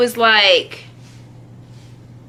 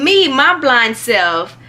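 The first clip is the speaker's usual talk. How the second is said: usual speaking voice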